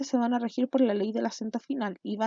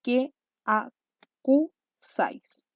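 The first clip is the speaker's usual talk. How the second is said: very slow, each syllable standing alone; teacher spacing out syllables to be counted; much slower than usual. Shown as a demonstration. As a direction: break it into separate syllables with long gaps. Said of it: "¿Cuántas sílabas teníamos en total?".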